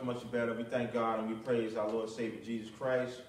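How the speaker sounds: ordinary talk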